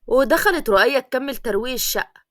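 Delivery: usual speaking voice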